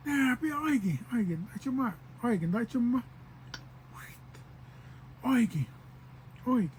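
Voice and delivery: a lightly voiced cool guy calling Oiki from the truck; mild casual call from the truck. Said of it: "trying to be all cool".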